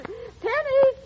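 calling out. Acted. Calling daughter from the house.

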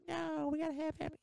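high-pitched mimicry of her demand; mock insistence. Mimicking the bride insisting on a big wedding, high mock insistence.

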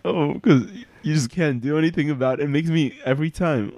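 choked-up plea about mom; tearful. Real, not acted. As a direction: voice still wavering with emotion. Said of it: "we all just broke down crying".

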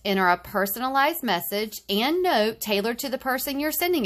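bright, loud retro ad read; chipper 1950s ad. Acted. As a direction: sell it like a vintage commercial.

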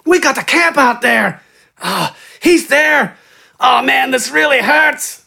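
high, desperate pleading in short bursts; pained gasps. A narrator's reading. Injured bandit giving directions, gasping in pain. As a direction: gasp it out high, through agony. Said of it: "The man spoke between pained gasps".

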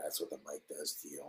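ordinary delivery

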